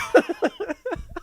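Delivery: a high, shrieking cackle. Real, not acted. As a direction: burst into a squealing laugh. Cracks up at own quote.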